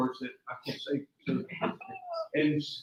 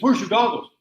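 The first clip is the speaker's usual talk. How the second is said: higher, louder demand; sharp louder question; 7 semitones higher, 10 dB louder than usual. Retold or imitated. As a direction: call it out, alarmed. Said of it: "he says".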